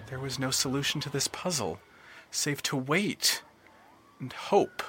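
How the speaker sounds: hushed resignation. A narrator's reading. Narrator going quiet as Leon gives up hope. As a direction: let it sink to near nothing. Resignation after roller coaster peak.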